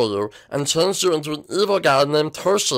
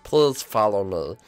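much lower and softer, coaxing; low quiet pleading; 8 semitones lower, 5 dB quieter than usual. Acted. Helper coaxing Toshi upstairs, low and soft. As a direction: beg him to follow.

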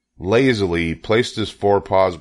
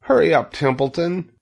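anxious high-voiced prodding; urgent plea; 11 semitones higher than usual. A narrator's reading. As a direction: urge him on, high and pressing. Wilbur begs the rat.

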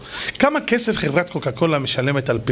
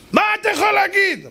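high-pitched challenging yell; acted shouting. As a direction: imitate an angry heckler shouting back. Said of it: "מה אתה צועק". (צעקה מתגרה בקול גבוה)

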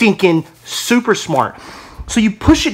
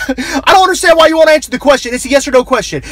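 higher, louder, incredulous host; exasperated radio host; 7 semitones higher, 4 dB louder, faster than usual. Retold or imitated. Host repeating the demand at the evasive guest, incredulous. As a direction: laugh it out like a fed-up host.

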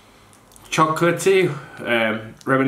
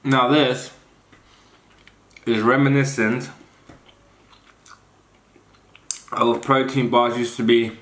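mouth full. Real, not acted. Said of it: "I had my mouth full for a long time".

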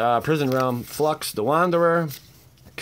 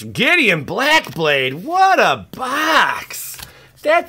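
higher, louder, slow drawn-out exclamation; amazed; 11 semitones higher, 7 dB louder, much slower than usual. Real, not acted. Revealing the last big card, slow and loud. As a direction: draw out what a box.

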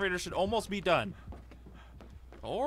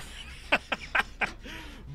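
high laugh; 9 semitones higher than usual. Real, not acted. Gamer laughing as teammate Bill dies, laughing.

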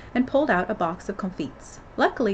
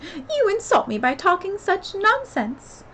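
high, loud, slow outrage; affronted storybook Mouse; indignant; 11 semitones higher, 5 dB louder, slower than usual. Acted. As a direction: scold with drawn-out offended emphasis. Offended Mouse storms off.

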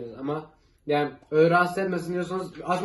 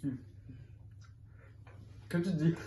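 chewing with hand over mouth; muffled behind hand; mouth full of pizza; 9 dB quieter than usual. Real, not acted. Seen 0: Bites pizza at -1, then chews behind hand while speaking.

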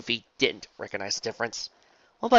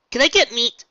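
put-on loud, high eager pirate boy; 12 semitones higher, 10 dB louder than usual. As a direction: shout it hungrily. Luffy character voice.

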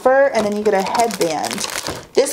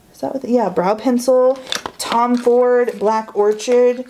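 ordinary talk

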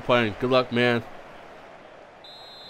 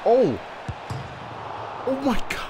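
high-pitched, startled exclamation; startled dismay; 12 semitones higher than usual. Real, not acted. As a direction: yelp as the play goes wrong. Startled by play in the game.

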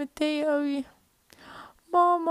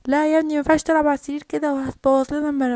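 normal talking voice

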